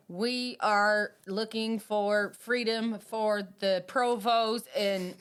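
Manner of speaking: put-on slow, drawn-out movie-villain Irish accent; movie Irish terrorist. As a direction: slowly intone it like a Hollywood Irish gunman. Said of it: "like the start of Die Hard".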